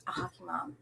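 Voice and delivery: breathy soft repeat; whispered echo. Real, not acted. Echoing the hockey mom remark in a whisper.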